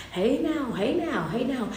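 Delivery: usual speaking voice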